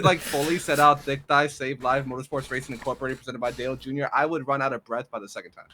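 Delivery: high, loud banter; loud boast. Real, not acted. Racer jokes he would run out of breath, loud boast. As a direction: rattle off the long name loudly. Joking challenge to say long name.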